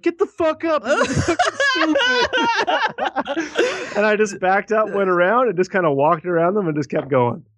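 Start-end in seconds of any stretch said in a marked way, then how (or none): 0.0-2.0 high-pitched laughing jeer; mock the guy who slipped, laughing; mocking jeering friend. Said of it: "his friend's like". 2.0-3.9 shrill imitated cackle; high mocking laugh. Friend laughs at fall.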